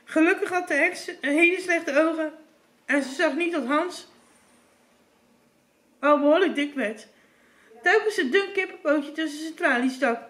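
plain reading voice

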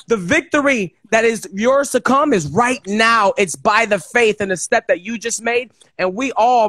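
ordinary talk, nothing marked

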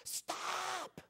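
high, snarling demonic shriek. Retold or imitated. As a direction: snarl it out like a possessed girl. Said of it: "A snarling voice".

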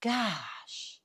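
a faint, soft, awed gosh. A narrator's reading. Jim stunned by the tall man, faint and soft. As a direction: barely breathe the word out. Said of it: "and Jim said faintly".

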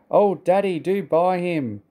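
put-on high, drawn-out childlike begging; pleading. A narrator's reading. Boys begging Daddy to buy Trevor, pleading. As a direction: plead slowly, stretching the key words. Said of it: "pleaded the boys".

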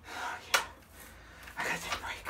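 breathy, barely voiced; too winded to use your voice; breathless and dizzy. Real, not acted. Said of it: "Are you dizzy?".

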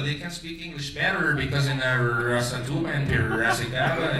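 ordinary delivery

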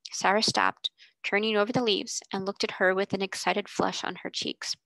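usual speaking voice

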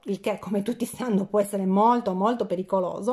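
ordinary delivery

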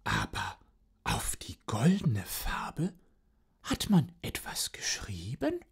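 hoch und geflüstert, verschwörerisch; flüstere wie eine listige Katze. Acted. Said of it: "sagte die Katze". (Katze, hoch geflüstert)

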